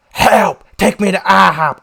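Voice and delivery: loud, high-pitched cry for help; yelling. Retold or imitated. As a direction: holler it across a busy road. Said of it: "he was yelling at me".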